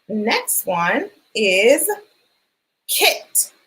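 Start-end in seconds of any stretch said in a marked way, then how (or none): 2.9-3.5 kit breathed out with no voice; whispered kit. Shown as a demonstration. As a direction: whisper the word kit to the class. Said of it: "What sound do we hear at the beginning of kit".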